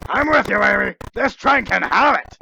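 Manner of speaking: high, loud rallying cry; excited shout. Acted. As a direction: cheer her on, fired up. Joining Amy's outburst.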